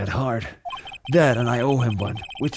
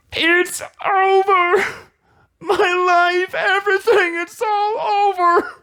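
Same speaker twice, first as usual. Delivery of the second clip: much higher, slow, hammed-up crying wail; wailing despairing boyfriend; 3 dB louder, slower than usual. Grieving client wailing in the lobby, hammy high crying. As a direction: wail it like a melodramatic wreck.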